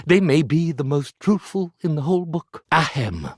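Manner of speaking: ordinary delivery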